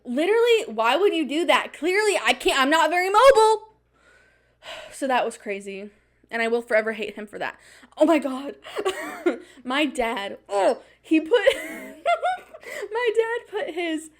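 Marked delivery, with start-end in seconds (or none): 0.0-3.7 high, loud, exasperated retort at the fast guide; exasperated complaint. Retold or imitated. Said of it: "I'm like". 8.0-14.1 real laughter, words squeezed out high; laughing fit. Real, not acted.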